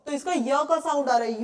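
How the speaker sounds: usual speaking voice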